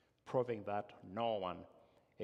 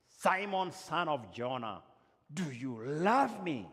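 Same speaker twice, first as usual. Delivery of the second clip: loud, much higher call of the name, then the question; pleading Jesus; 5 semitones higher, 7 dB louder, slower than usual. Acted. Jesus asks again.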